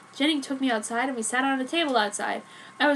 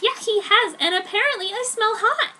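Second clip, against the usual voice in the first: put-on higher, louder happy teen voice; roared happily; 9 semitones higher, 5 dB louder than usual. Joan answering Alice, happily roared. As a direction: toss it out gleefully. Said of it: "I roared happily".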